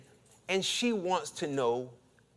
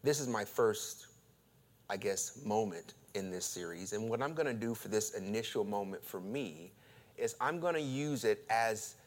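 softer, measured pulpit voice; sermon intro; 7 semitones lower, 9 dB quieter than usual. Acted. Pastor introducing a new sermon series, soft and measured. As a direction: open the sermon gently.

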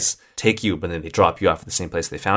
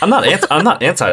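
high, loud protest; emphatic protest; 9 semitones higher, 6 dB louder than usual. Real, not acted. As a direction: insist you're not anti. Laughing denial of being anti.

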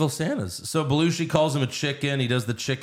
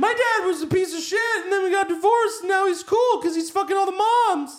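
put-on much higher, nasal bratty kid. Podcaster voices Jamie's asshole friend taunting him, high kid voice. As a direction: taunt like a smug schoolyard brat. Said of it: "he's like".

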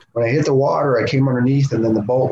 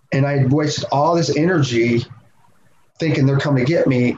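ordinary delivery

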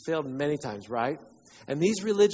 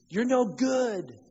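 accuser's taunt; 6 semitones higher, much slower than usual. Acted. Preacher voices the devil's accusation.

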